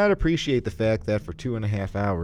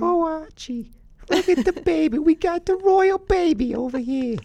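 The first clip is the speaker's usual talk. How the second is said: very high squeaky put-on voice; silly English baby-gusher; 7 dB louder than usual. Co-host cooing over the royal baby, silly high English voice. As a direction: gush in a loud falsetto English voice.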